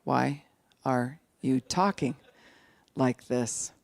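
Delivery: halting question, pauses between words; very slow. Acted. Wife copying husband's slowed talk, very slow. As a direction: ask it word by word, slowly. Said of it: "she was like".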